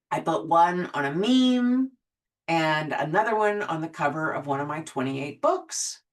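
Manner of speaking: ordinary talk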